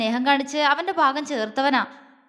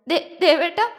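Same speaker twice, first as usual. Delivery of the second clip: sobbing call; 5 semitones higher than usual. A narrator's reading. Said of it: "അവൾ തേങ്ങിക്കൊണ്ട് വിളിച്ചു".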